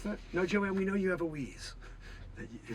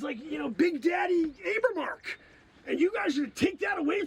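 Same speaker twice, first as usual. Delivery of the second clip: indignant rant; 8 semitones higher than usual. Acted. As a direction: rant at them like you've been robbed. Outraged rant at being replaced.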